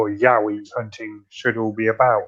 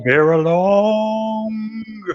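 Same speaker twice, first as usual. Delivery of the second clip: high, drawn-out howl of a question; Australian Yowie howl; 9 semitones higher, slower than usual. Pretend. Wondering how an Australian Yowie howls, a long high mock howl. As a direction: howl it slowly, stretching the last word. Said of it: "I wonder how a Australian, uh, a Yowie howls".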